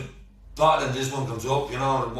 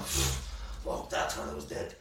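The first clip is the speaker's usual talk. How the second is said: whisper; 13 semitones lower, 12 dB quieter, much slower than usual. Retold or imitated. Inner thought while scared.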